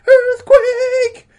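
shrieking scream. A narrator's reading. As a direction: scream it even harder. Repeated alarm, second take.

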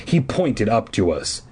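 usual speaking voice

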